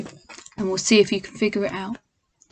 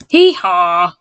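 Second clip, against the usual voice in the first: lower, louder hee haw; donkey bray; 4 semitones lower, 5 dB louder than usual. Acted. Donkey sees sandwiches, louder bray. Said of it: "brayed the donkey".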